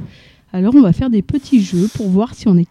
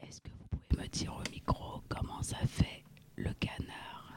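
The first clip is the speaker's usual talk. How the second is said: whispering; 22 dB quieter than usual. Shown as a demonstration. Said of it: "quand on parle tout bas comme ça".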